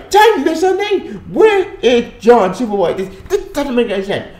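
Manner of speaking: high-pitched, baffled outrage; incredulous protest. Real, not acted. Reviewer asking where John Superboy is, incredulous.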